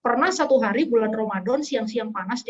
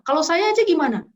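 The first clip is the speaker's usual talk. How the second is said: eager offer; 7 semitones higher, 5 dB louder than usual. Retold or imitated. Volunteering herself, retold.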